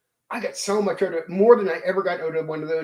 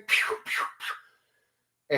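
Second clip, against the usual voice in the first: whispered pew pew laser shots; breathy mock blasts like a toy laser gun; laser gun noise; 6 dB quieter, much slower than usual. Fighter firing the laser gun at goblins, whispered pew pew. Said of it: "and he's like".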